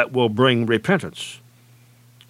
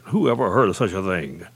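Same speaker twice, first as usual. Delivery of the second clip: ordinary delivery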